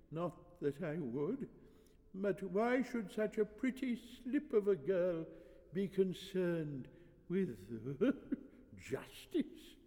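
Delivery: high, halting voice with a chuckle; doddering old priest. Acted. Old priest flattering a pretty girl, doddering chuckle. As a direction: chuckle indulgently as a frail elder.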